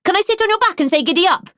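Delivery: put-on a small boy thrilled by a game; eager little boy. A narrator's reading.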